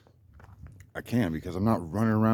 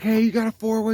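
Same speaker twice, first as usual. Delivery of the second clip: much higher, louder call to the neighbor; 13 semitones higher, 6 dB louder than usual. Retold or imitated. Asking the neighbor for a wrench, calling out high. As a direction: call out eagerly across the yard. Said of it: "I'm like".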